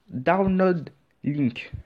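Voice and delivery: English accent attempt. Shown as a demonstration. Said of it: "j'ai pas vraiment l'accent anglais".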